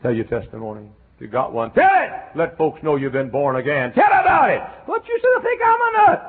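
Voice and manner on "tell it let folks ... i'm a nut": very high, loud shouting; exhorting shout. Acted. Preacher shouts at the congregation to testify. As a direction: shout it to them.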